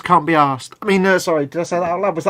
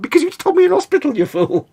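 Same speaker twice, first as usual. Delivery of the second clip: exasperated retort; 13 semitones higher, 4 dB louder, faster than usual. Retold or imitated. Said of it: "I'm like".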